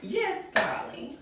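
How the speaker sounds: high breathy whispered yes darling; soft high hush; high airy yes. Acted.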